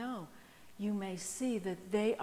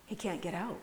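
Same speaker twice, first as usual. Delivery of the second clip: usual speaking voice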